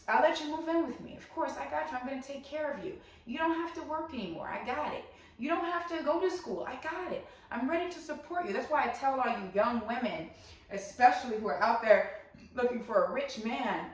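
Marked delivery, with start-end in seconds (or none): 0.1-8.5 higher, softer coaxing promises; reassuring provider. Acted. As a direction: soothe a partner into depending on you. Acts reassuring provider to partner.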